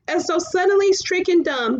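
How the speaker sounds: ordinary delivery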